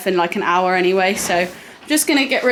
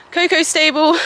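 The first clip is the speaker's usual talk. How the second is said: ordinary talk, nothing marked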